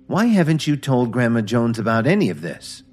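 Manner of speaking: sharp, accusing demand; snapped. Acted. Brittany confronts the engineers for staying silent, snapping. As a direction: snap at them for hiding it. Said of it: "Brittany snapped".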